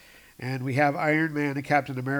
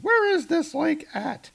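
high falsetto Jane; hammed-up high Jane voice; Jane's voice; 3 dB louder than usual. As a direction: squeak it much higher. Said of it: "Jane's asking".

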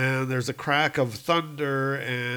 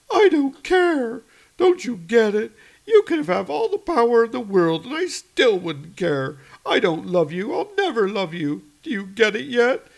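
Maureen's voice; 10 semitones higher, 6 dB louder than usual. Acted. Maureen rejecting the villain.

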